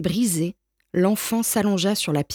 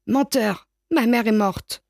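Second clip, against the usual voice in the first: tearful outburst; 4 semitones higher, slower than usual. A narrator's reading. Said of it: "Les larmes jaillirent des yeux".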